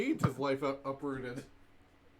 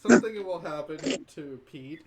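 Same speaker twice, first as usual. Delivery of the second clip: "Something will happen" said louder, as if in quotes; in funny quotes; 7 dB louder than usual. Acted. Player hinting at Pete's fate, "Something will happen" set in quotes. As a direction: put audible quote marks round "Something will happen". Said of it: "It's going in funny quotes".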